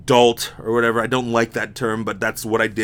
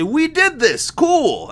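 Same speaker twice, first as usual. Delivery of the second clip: mock corporate voice; 14 semitones higher, slower than usual. Mimicking corporate people.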